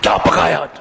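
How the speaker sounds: mock shout, pushed but not full volume; mock yell. Husband demanding his dinner from his wife, mock yell. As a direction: imitate a yelling husband. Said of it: "शौहर घर में आके चीख के बोलता है".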